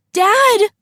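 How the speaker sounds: high, desperate call for a parent; scream. A narrator's reading. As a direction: scream out in panic. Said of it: "Akira screamed".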